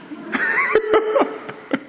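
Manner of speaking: high, airy chuckling; real laugh. Real, not acted. Preacher after joking about hating melon, airy laugh. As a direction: laugh at your own confession.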